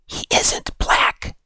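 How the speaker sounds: slow, hushed whisper. Acted. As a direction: whisper it slowly, under your breath. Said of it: "whispered Natalie".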